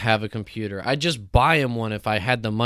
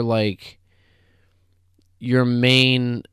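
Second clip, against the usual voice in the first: ordinary delivery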